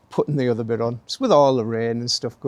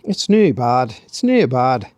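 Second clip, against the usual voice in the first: put-on a light Scottish accent, louder; 6 dB louder, slower than usual. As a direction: say "It's near bad" in a Scottish accent. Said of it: "I went into a Scottish accent then".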